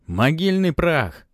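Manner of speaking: slow, high, loud curse; heated shout. Acted. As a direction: hurl the curse slowly and loud. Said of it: "крикнул в запальчивости Матвей".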